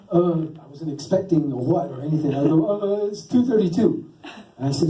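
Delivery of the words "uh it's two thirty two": raised pitch, speaking up nervously; higher, more insistent second try. Retold or imitated. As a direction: repeat it up high for those who missed it. Repeats answer after a startled "What?.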